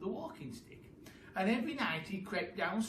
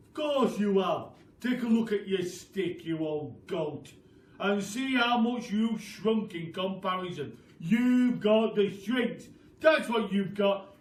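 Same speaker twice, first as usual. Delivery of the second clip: taunting Mr Twit; 3 semitones higher, 9 dB louder than usual. Acted. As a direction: drag out every word to scare her.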